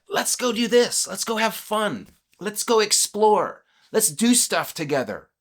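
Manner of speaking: high-pitched, eager invitation to adventure; excited, playful urging; eager enthusiasm. Acted. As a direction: pitch it high, like proposing fun together. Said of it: "filled with joy and enthusiasm".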